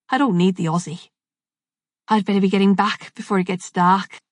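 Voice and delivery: lightly voiced Scouse bloke turning down help; a hint of Liverpool, brushing it off; mild Scouse accent. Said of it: "spoke in a Scouse accent".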